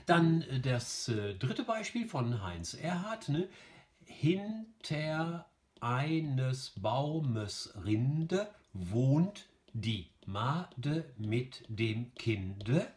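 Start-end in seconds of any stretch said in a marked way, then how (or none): 4.1-12.9 Hin-ter ei-nes Bau-mes, very slow, each syllable apart; slow, separated beats on "Hinter eines Baumes Rinde wohnt die Made mit dem Kinde". Shown as a demonstration. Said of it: "entspricht also wieder dieser ersten Variante".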